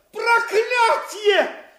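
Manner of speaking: slow, loud, furious curse. Acted. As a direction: bellow the curse out long. Villain curses his loss. (громкое проклятие)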